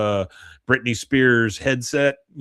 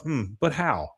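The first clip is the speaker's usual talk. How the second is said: quieter musing to herself; Peggy pondering privately, much softer; 3 semitones higher, 8 dB quieter, slower than usual. Retold or imitated. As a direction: mull it over under your breath. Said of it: "says to herself".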